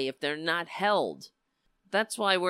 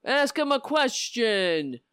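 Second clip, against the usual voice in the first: mock indignant shout; 8 semitones higher, 6 dB louder than usual. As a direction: bellow it mockingly, stretching the words. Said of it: "he says".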